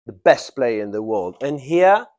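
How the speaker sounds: ordinary delivery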